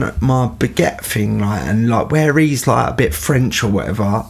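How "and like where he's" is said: ordinary talk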